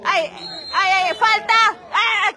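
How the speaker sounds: high, loud ay ay ay; excited cries. Real, not acted. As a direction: cry out at the foul. Foul on the field, cries out.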